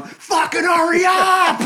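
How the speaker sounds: loud, slow, high mock yell with a laugh; retold yell. Drunk guy yelling at the beer line, laughing loud. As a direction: bellow it like an impatient drunk. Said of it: "he's just yelling".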